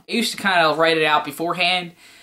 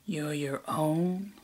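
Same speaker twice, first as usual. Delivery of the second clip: slow, much softer sinister line; hushed villain line; 10 dB quieter, much slower than usual. Acted. Villain delivers the punchline slow and hushed. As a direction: drag it out quietly.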